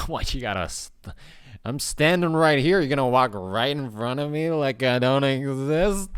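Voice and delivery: high, loud mock outrage at being ignored; hammed-up indignation, voice up high; mock indignant rant. Ranting at a man who walked in front of him, hammed-up outrage. Acted rant at rude passerby.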